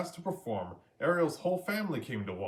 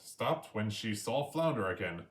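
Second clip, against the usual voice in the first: usual speaking voice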